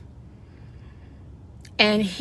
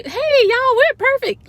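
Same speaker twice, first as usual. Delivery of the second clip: much higher and louder, a gleeful party cheer; cheering cells; 8 dB louder than usual. Retold or imitated. Cells cheering to each other, high and loud excitement. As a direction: squeal it out like celebrating cells. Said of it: "The cells look around at each other".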